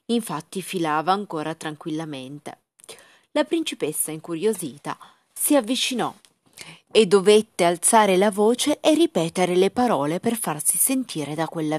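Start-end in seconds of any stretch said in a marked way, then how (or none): none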